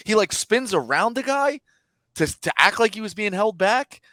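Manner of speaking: ordinary talk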